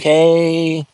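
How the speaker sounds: a long, dragged-out "Hey."; drawn-out hey. Retold or imitated. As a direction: stretch "Hey" long, like it has six Ys. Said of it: "six Ys".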